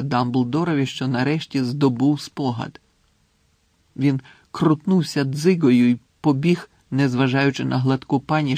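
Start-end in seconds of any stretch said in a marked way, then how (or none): none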